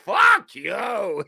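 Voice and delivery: a half-laughing shouted curse, copying the clip; mock shout. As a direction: throw it back like the angry fan. Echoing the clip's outburst.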